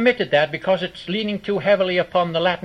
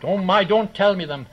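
ordinary talk, nothing marked

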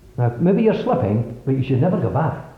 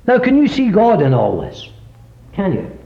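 fervent preaching climax; 8 semitones higher, 4 dB louder than usual. Real, not acted. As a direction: build it with conviction. Preaching at full force.

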